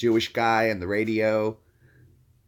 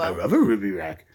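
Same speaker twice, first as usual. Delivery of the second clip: Scooby-Doo impression. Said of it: "I can do Scooby-Doo".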